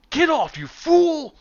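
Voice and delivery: high, loud, slow indignant shriek; a fussy wizard shrieking at a fool; the wizard snapping at the oaf who grabbed him, in a high, loud shriek; angry wizard. Acted. Wizard NPC shoves players off.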